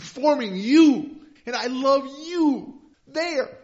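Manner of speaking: loud, high, swelling voice of God in the psalm; full-force preaching. Acted. Pastor voicing God to the congregation, full-force preaching.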